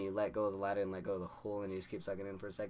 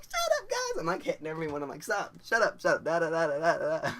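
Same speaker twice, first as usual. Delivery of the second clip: very high, loud squealing shut up then babbling; giggly freakout; 8 semitones higher, 9 dB louder than usual. Retold or imitated. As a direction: shriek over them in silly panic. He freaks out at friends.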